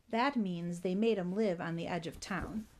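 ordinary delivery, nothing marked

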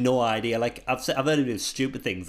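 ordinary talk

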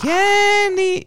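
high sing-song call of Kenny in a mock Chinese accent. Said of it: "did they do that in, like, a Chinese accent?".

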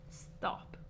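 whispered to herself. Real, not acted. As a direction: breathe out a whisper, stop. Laughing, calming herself.

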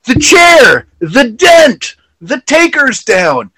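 high, loud cries in short bursts; hammed-up alarm, each phrase yelled; mock frantic shouting. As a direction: imitate a wrestler wailing in disbelief. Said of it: "He's like".

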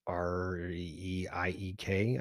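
slow, letter-by-letter spelling. Shown as a demonstration. Explaining the name to co-host, slowly spelling out. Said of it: "I've adopted saying".

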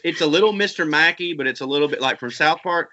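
normal talking voice

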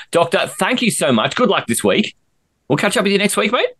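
ordinary talk

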